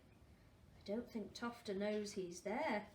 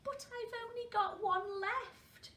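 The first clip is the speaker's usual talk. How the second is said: dismayed cry; 10 semitones higher than usual. Acted. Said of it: "cried Tofter".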